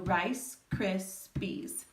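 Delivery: rice, cris, peas with shorter pauses between; syllables closer together. Shown as a demonstration. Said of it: "said a little bit closer".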